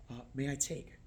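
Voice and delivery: soft, unobtrusive question; discreet offer. Shown as a demonstration. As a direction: ask quietly, as if not to intrude. Right way of clearing plates.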